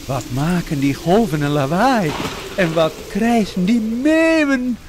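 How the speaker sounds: high, loud, wondering call; Jojo calling. A narrator's reading. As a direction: call out over the noise of the sea. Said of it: "riep Jojo".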